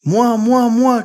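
put-on high, loud eager kids; children begging the magician to choose them; children shouting. A narrator's reading. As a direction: yell to be picked. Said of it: "crient tous les enfants".

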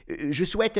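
high, halting first try; hesitant. A narrator's reading. Professor trying out his wish, hesitant. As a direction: start the wish uncertainly, pitched high.

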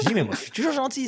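high-pitched protest of innocence; squeaky-high defensive retort; mock protest. Acted protest to his wife's remark.

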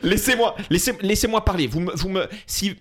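hammed-up Corsican dad voice; imitating his Corsican father. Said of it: "je réponds avec mon père".

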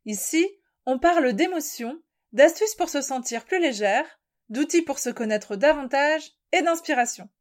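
smooth welcome, like a radio jingle voice; podcast intro. Acted.

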